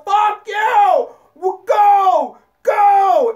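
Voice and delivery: yelled high and loud out a car window; road rage scream. Retold or imitated. Driver screaming out the window in road rage, full yell. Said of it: "screaming out the window".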